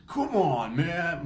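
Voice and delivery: retold mild exasperated plea; mock exasperation. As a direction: imitate an exasperated man appealing for reason. Mustache fella's exasperated reply.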